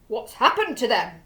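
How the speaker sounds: loud, anxious duke asking; worried loud question. A narrator's reading. Duke asks the narrator what went wrong, loudly. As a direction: call out in alarm. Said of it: "the Duke asked me".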